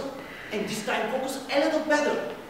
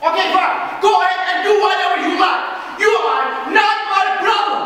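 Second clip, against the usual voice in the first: high, loud, rushed shout; furious shout; 5 semitones higher, 11 dB louder, faster than usual. Acted. Actor shouts at his partner in the quarrel, furious. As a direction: fling the words and wash your hands of him.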